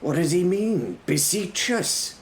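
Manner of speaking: high, puzzled questioning; confused. A narrator's reading. Defender asks his companion about the word, puzzled. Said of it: "lifted my hands in confusion".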